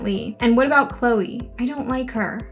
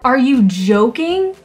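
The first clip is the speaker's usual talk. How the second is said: loud, stretched-out disbelief; indignant outburst; 2 semitones higher, 6 dB louder, slower than usual. Real, not acted. Player rejected by Theo after feeling a connection, indignant outburst. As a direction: protest loudly, drawing out the key words.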